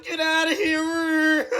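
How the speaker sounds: high, drawn-out storybook yell; angry chasing shout. Acted. Man chasing the monkey off, high acted shout. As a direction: holler it high and slow, acted fury. Shocked man chases George away.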